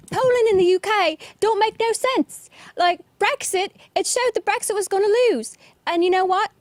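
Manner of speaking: high, loud mock British accent. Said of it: "that was my British accent".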